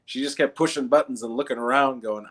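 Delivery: ordinary talk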